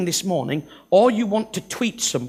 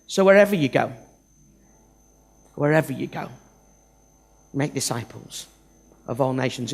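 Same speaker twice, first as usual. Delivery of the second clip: gentle translation; 6 semitones lower than usual. Shown as a demonstration. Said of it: "The more accurate translation is this".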